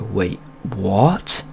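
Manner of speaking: mildly puzzled question, rising at the end; confused double take. Retold or imitated. Storyteller replays his looping reply to his friend, mildly baffled. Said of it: "That's what I'd say".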